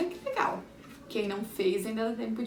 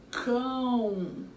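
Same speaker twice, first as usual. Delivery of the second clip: "cão" held long as one beat; long, isolated "cão"; single-syllable demo. Shown as a demonstration. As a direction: stretch "cão" in one mouth opening. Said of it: "Quantas vezes eu abri minha boca".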